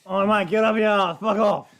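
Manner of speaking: loud, high Australian bogan shout; bogan yell. Acted. Bogan yells from car window, loud Australian jeer. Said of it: "being yelled by a bogan".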